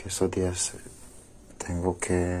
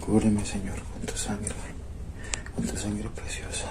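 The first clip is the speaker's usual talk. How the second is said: whispered prayer; 2 semitones higher, 5 dB quieter than usual. Real, not acted. Praying in fear at night.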